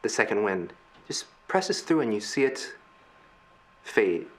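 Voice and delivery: low, hushed narration with long pauses; game master's scene setting. Acted. Game master shows players the wreckage, hushed narration. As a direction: set an eerie scene, unhurried and soft.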